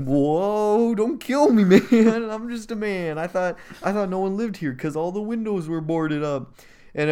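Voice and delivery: high, loud hammed-up begging; mock panicked pleading. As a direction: plead for your life in comic panic. Intruder begs not to be shot.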